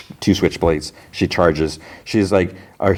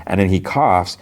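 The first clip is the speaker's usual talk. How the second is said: ordinary talk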